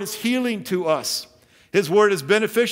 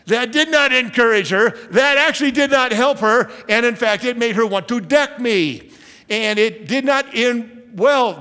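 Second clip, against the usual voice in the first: raised, louder preaching; louder emphasis; 6 semitones higher, 6 dB louder than usual. Real, not acted. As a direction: push it out with rising force.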